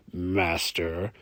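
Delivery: drawn-out "Master" with a rising end; sarcastic. A narrator's reading. Brune teases Hamish on leaving, sarcastic "Master". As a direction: needle him with the title. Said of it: "he then added sarcastically".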